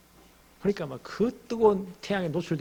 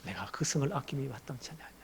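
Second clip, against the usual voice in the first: much softer, tender echo of God's words; soft quoted question; 2 semitones lower, 10 dB quieter than usual. Acted. Preacher repeating God's question, soft and tender. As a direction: say it gently to the congregation.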